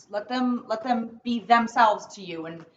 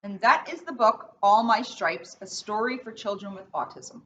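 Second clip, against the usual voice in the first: put-on reading to children. Officer reads picture book aloud.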